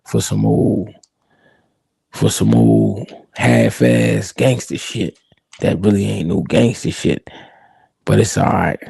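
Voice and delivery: deep, slow, unhurried elder voice; slow low old boss. Retold or imitated. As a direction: play the calm old gangster boss, taking his time. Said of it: "He gonna say".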